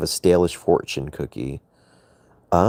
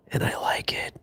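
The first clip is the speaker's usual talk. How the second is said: breathy mock-flirty whisper; 10 dB quieter than usual. Streamer teasing chat in a flirty whisper. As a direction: breathe it like a secret in their ear.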